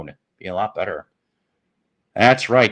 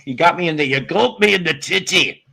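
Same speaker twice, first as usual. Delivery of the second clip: ordinary delivery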